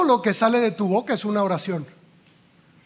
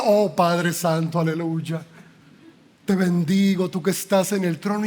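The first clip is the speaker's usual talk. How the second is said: mock pious prayer; 5 semitones lower than usual. Mocking pious prayer after cursing. (rezo piadoso exagerado, grave y lento)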